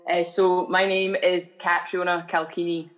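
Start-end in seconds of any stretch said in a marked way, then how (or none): 1.6-2.9 Catriona Calchini said as kat-ree-OH-na kal-KEE-nee; correct pronunciation. Shown as a demonstration. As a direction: model "Catriona Calchini" with a hard K in Calchini. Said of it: "uh, pronounced wrong".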